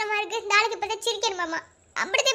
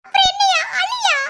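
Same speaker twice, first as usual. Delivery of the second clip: exasperated yell; 9 semitones higher, 6 dB louder than usual. Acted. Wife exasperated at laughing husband.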